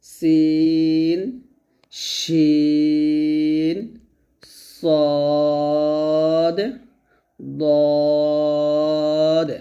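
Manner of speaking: সিন শিন সদ দদ each held long; letters sustained one by one; held letter drill. Shown as a demonstration. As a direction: stretch each letter slowly for repetition. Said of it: "আমার সাথে আবার বলেন".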